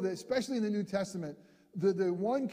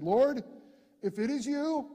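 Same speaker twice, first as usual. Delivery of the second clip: Peter calling out; 5 semitones higher, 4 dB louder than usual. Acted. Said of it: "Peter answered him and said".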